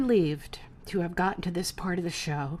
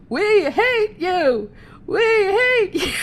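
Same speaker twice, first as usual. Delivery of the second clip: high, loud, slow hammed-up jeer; mock heckler yell; 11 semitones higher, 8 dB louder, much slower than usual. Teller playing the jeering crowd, loud hammed-up yell. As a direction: shout it like a hateful crowd.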